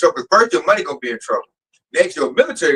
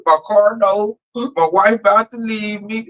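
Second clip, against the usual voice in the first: mock crying complaint, higher; imitating sobbing; 4 semitones higher than usual. Mimicking a coworker crying about money, mock sob. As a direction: whine it like a broke man sobbing. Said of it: "I heard sob stories every day".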